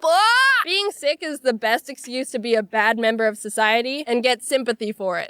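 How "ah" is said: sudden high, loud mock scream. Sick kid blows up at her friend, mock scream. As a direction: shriek to end the joke.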